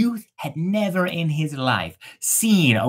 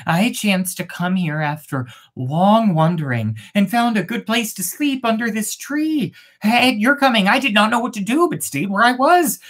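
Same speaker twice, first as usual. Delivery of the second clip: lightly voiced pleading youth, higher; humble youth; 7 semitones higher than usual. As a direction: apologize humbly to the maiden. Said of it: "The youth answered humbly".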